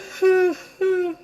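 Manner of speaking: high sobbing wail; crying wail. Acted. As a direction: burst into loud sobs. Zebra wailing.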